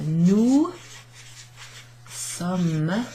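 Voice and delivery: "nous ... sommes" drawn out, long pause between the words; slow demonstration. Shown as a demonstration. Teacher writing out nous sommes for learners, slow demonstration. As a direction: say "nous" and "sommes" separately, each stretched. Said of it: "Pronounce like the word Somme".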